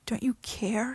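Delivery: hushed desperate plea; begging in a whisper; pleading. Acted. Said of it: "he pleaded".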